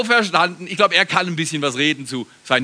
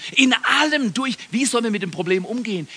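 ordinary talk, nothing marked

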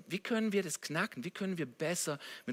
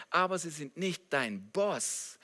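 ordinary delivery

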